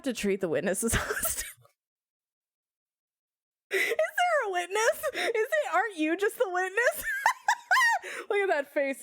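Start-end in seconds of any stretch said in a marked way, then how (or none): 3.7-7.0 high, laughing teasing question; laughing tease. Real, not acted. Laughing tease at Jake as the witness. 7.1-8.3 high burst of laughter. Real, not acted. High laugh at Jake in mock court.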